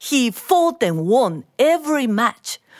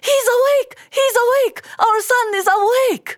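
high, joyful shouting, faster at the end; overjoyed cry; faster than usual. Acted. Wife running out to tell Mister Lee, overjoyed. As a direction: cry out the happy news.

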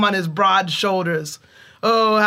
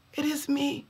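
faint reply; 7 semitones higher, 10 dB quieter than usual. A narrator's reading. Weak brother deep in pit.

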